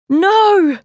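very high, loud cry of alarm. A narrator's reading. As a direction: cry out to stop a disaster. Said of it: "cried Ty and Anna".

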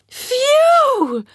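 high relieved sigh; relieved phew. Acted. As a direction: huff out relief. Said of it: "said all the kids and adults".

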